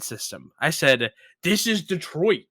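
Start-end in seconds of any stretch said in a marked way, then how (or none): 1.4-2.4 insistent. Retold or imitated. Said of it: "I lose my mind".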